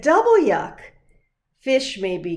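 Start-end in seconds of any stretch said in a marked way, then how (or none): 0.0-0.8 loud high double yuck; kid's loud double yuck; disgusted. Acted. Girl smells the fish too.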